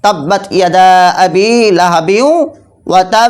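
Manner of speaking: measured tajweed demo, তাব ending in a strong echo; strong qalqalah recitation. Shown as a demonstration. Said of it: "খুব শক্তিশালী হবে".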